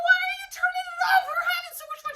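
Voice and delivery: high, fast, loud whining protest; mock outrage at mom turning the movie off; whiny protest. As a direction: protest it like indignant kids. Kids protesting loudly.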